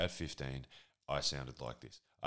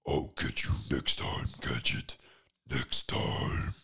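loud, growling cartoon villain rasp; Doctor Claw; 2 semitones lower, 9 dB louder, slower than usual. Villain threatening Inspector Gadget, loud guttural growl. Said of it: "Doctor Claw impression".

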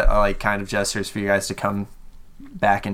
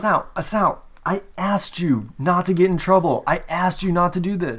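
higher, pained friend begging Sal; guard reproaching his friend Sal, voice pitched up in distress; pleading scold; 8 semitones higher than usual. Acted. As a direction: plead with him, hurt he didn't listen.

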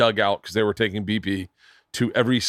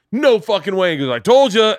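higher and louder, gleeful brag; excited ballplayer; 9 semitones higher, 7 dB louder than usual. Retold or imitated. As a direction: crow it like you just nailed a bet. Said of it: "And Fred McGriff's like".

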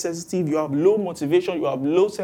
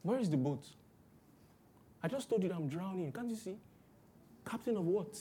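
much softer private despair; inner thought; 2 semitones higher, 11 dB quieter than usual. Retold or imitated. A drowning believer answering the advice inside his head, softly. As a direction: murmur it to yourself, exasperated. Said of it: "you're thinking".

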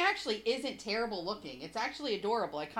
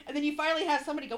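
ordinary talk

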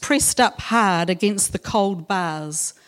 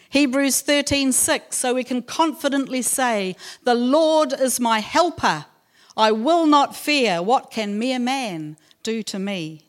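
raised, measured Bible reading voice; scripture reading; 4 semitones higher than usual. Acted. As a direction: read each verse out like a proclamation. Reads scripture verses aloud.

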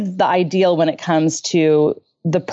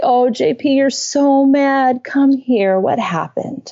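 put-on tender, higher comforting voice for a hurt child; soothing; 5 semitones higher, slower than usual. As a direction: soothe him as if he had been physically hurt. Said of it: "respond like I do when he's physically hurt".

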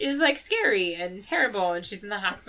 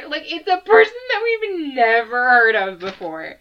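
laughing; 2 semitones higher, 7 dB louder than usual. Real, not acted. Still laughing exasperated.

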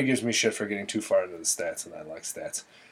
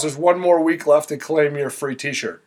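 ordinary delivery

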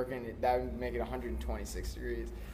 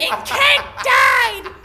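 put-on very high, slow old lady; 14 dB louder, much slower than usual. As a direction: drag it out in a shrill granny voice. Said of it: "She's like".

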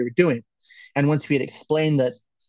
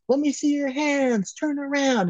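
high, stretched-out mock yell; imitated police commands; 13 semitones higher, slower than usual. Retelling police ordering kids at gunpoint, mock shouting. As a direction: call out orders like a cop from afar. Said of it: "And then we start hearing".

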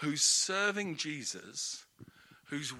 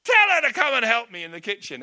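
higher, louder, scolding a little brother; exasperated complaint; 6 semitones higher, 12 dB louder than usual. Retold or imitated. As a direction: scold Jesus like a big sister, loud and high. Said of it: "It's like".

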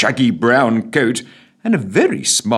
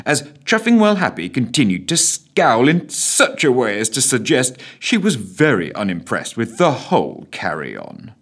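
usual speaking voice

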